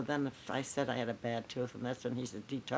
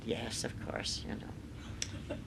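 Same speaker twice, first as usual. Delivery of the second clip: hushed aside; 4 semitones lower, 9 dB quieter, much slower than usual. Retold or imitated. Placating the sulking star.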